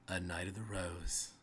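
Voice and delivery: name the knight with quiet, cool weight; feminine rider voice. Acted. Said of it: "feminine voice".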